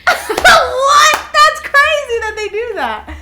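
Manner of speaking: high, loud laughing shout; real laughing disbelief at the news; shocked laugh. Real, not acted. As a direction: shriek it through laughter.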